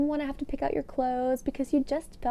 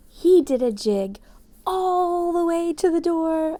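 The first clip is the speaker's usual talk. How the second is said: excited narration; 7 semitones higher, 8 dB louder than usual. A narrator's reading.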